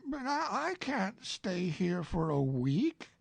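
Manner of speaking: lightly voiced Winnie-the-Pooh, polite.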